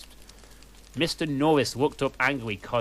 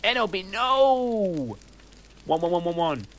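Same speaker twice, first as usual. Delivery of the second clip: Draco's scream; 8 semitones higher than usual. Acted. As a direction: drag out the no like a bad actor. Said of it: "Screamed Draco".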